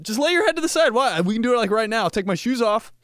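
high, loud overeager persuasion; eager coaxing. Retold or imitated. Still begging friends all day to lie down, high eager coaxing. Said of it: "The whole day I'm like".

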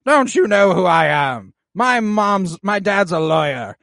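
high, loud entitled brat; bratty Aegon. Retold or imitated. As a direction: whine it like a spoiled prince. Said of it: "Aegon goes".

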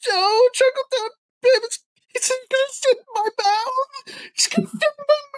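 very high mock wail; Lou Wilson crying-laughing. Player answering whether the pirate will help, wailing hysterically. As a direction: squeal it out through imitated sobs. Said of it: "can't tell if Lou is crying or laughing".

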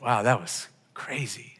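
slow, soft, half-whispered wonder; hushed amazement. Retold or imitated. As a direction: let it out slowly, under the breath. Retold amazement after the prayer.

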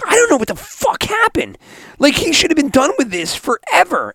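high-pitched baffled complaint; acted-out phone rant; retold exasperation. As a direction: vent in disbelief, pitch way up. Retells exasperated phone call to Dad.

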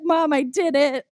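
high, loud mock-emotional announcement; tearful triumph. Daughter telling mom she brought him here, mock-tearful triumph. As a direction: proclaim it to mom, hammed-up and overcome. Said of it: "And I'm crying and I'm like".

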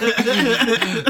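"Biker Grove" slow and high through laughter; laughing echo. Real, not acted. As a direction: laugh it back at your friend. Echoing the show name laughing.

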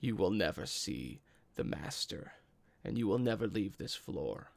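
low, quiet threat from a monster; menacing villain. Acted. Game master as fire newt taunting the fallen hero, menacing. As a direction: deliver a hushed, low-pitched menacing warning. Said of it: "and he says".

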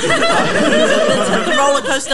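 slow loud shout through laughter; laughing shout. Real, not acted. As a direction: cheer it out while cracking up. Cheering while laughing.

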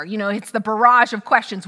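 ordinary talk

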